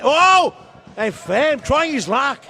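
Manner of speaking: high, loud burst then a slow shouted call; excited shot call. Real, not acted. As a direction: launch into the shot with a cry. Long-range shot call.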